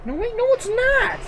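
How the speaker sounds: spooked, the words jump way up in pitch; freaked-out squeak. Real, not acted. Spooked by game pausing itself.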